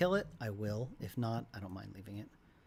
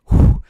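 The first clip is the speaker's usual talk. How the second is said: luide ademstoot; 25 dB louder than usual. Shown as a demonstration. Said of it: "talking like this".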